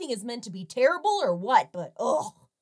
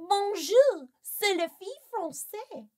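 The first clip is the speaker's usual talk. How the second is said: put-on French accent; 8 semitones higher than usual. Voicing French girls in pool.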